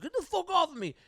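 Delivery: high-pitched, squawked protest; mock protest. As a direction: imitate a man resisting being dragged out. Retold man resisting removal.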